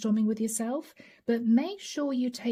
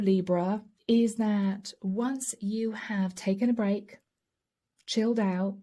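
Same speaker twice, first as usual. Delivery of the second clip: ordinary talk, nothing marked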